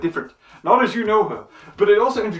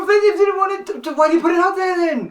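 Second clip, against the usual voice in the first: high, loud indignant outburst; indignant shout; 13 semitones higher, 4 dB louder than usual. Real, not acted. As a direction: protest it loudly.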